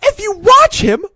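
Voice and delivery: real exasperated shouting; worked up. Real, not acted. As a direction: rant at the screen, voice cracking high. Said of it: "I'm getting too worked up".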